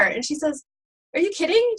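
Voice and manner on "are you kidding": feigned indignation. Acted. Joke's woman feigns innocence to police.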